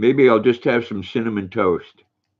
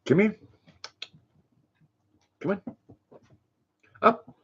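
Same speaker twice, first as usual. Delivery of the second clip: high, sing-song dog call; 10 semitones higher, 6 dB quieter than usual. Real, not acted. Owner calling her dog over, high and coaxing.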